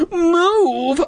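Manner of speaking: very high hammed-up yell; frantic yell. Boy tugs at trapped sister, frantic high yell. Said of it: "I yelled frantically".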